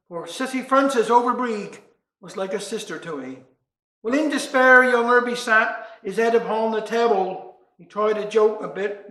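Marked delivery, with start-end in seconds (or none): none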